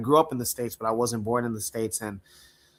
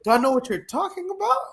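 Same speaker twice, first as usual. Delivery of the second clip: much higher, baffled question; mock bafflement; 13 semitones higher than usual. Retelling a reply to friends' slang, mock bafflement. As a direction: squeal it up high as a puzzled question. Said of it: "I'm like".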